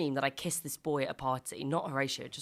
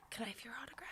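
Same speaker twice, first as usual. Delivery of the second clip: shy whispered request; 7 semitones higher, 16 dB quieter, faster than usual. Retold or imitated. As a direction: breathe the words like a nervous fan. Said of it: "she whispers".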